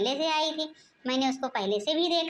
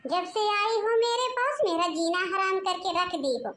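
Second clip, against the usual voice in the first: ordinary talk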